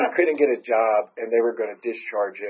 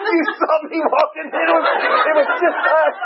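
much higher, louder, laughing through it; laughing disbelief; 7 dB louder than usual. Retold or imitated. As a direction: cry it out amid laughter. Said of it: "I'm like".

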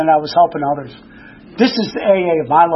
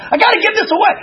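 much higher, bursting with eagerness; eager rush; 12 semitones higher, faster than usual. Retold or imitated. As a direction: blurt it like a man on fire. Newly sober, eager to share.

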